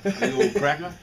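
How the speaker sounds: laughing comeback. Retold or imitated.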